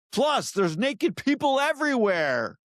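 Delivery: high, slow, hammed-up announcer; mock trailer voice. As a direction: ham up the trailer narrator. Trailer bumper, voice shifted.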